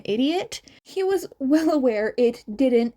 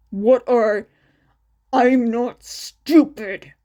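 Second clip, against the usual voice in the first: louder, slower angry retort; angry snap; 6 dB louder, slower than usual. Acted. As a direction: bite back, not fooled. Angry intruder cuts Shinso off.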